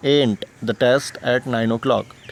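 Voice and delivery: "Ain't the test at nine o'clock" spelled out very slowly; slow model sentence for the class; slow demo. Shown as a demonstration. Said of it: "क्या बोल सकते हैं हम". (धीरे धीरे उदाहरण वाक्य)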